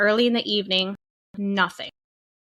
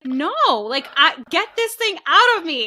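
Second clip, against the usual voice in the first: desperate plea; 7 semitones higher than usual. Retold or imitated. Begging resident during labor pain.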